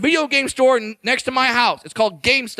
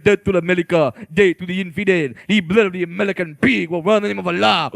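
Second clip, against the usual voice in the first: low, fast mock militant rant; caricatured extremist on a death tape; death-tape terrorist; 6 semitones lower, faster than usual. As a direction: rattle off hammed-up threats in a low voice. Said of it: "those death tapes. You're like".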